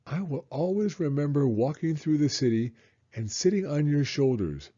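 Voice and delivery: a hint of a small boy talking to his dad; lightly voiced little boy. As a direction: read it as the excited little boy. Child voice reading son's lines.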